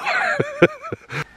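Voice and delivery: high wheezy laugh; laughing out of breath; breathless laugh. Real, not acted.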